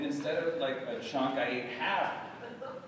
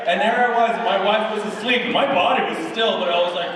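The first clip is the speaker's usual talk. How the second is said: normal talking voice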